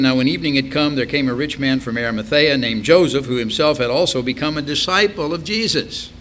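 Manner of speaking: projected Bible verse, rising in pitch; scripture reading. Acted. Preacher reading of Joseph of Arimathea, declaimed. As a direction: read the verse out clearly and firmly.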